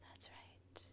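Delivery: barely audible, whispered aside; whispered reassurance. Acted. Guide to listener breathing out, whispered reassurance. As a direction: whisper it softly as encouragement.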